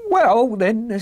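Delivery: high, sheepish voice; reluctant little man. Acted. Little man yielding to questioner, reluctant. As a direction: give in reluctantly.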